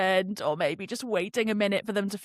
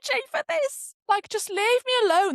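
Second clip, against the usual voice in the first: high, whiny mock-tearful plea; hammed-up fed-up whine; tearful exasperation; 13 semitones higher, 4 dB louder than usual. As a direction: wail it like you're overwhelmed. Retold tearful outburst on the train.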